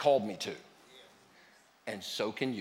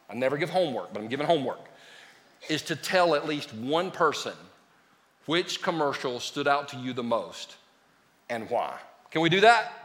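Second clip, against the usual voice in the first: ordinary talk, nothing marked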